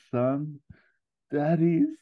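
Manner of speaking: high, strained father voice, cut off; Mustafa. Acted. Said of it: "And Ferrell says".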